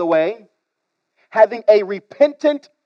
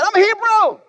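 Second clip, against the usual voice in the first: high, haughty boast; proud Jonah; 11 semitones higher than usual. Acted. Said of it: "But he said".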